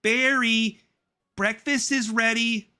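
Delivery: high, loud, cartoonish mum call. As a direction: call the son down to breakfast in a squeaky voice. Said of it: "Janet Benson".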